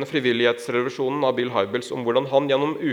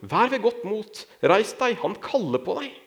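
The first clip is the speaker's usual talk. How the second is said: raised, high-pitched encouraging call; calling over; 7 semitones higher than usual. A narrator's reading. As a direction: call to the blind man, pitched up without shouting. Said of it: "De ropte på den blinde". (oppmuntrende tilrop i lyst toneleie)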